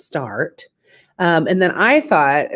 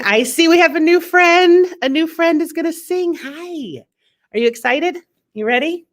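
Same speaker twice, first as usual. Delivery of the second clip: much higher, bubbly welcome; bright excited host; 11 semitones higher than usual. Real, not acted. To the next singer, an excited high welcome. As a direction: cheer on the new friend. Greeting next performer, kid voice.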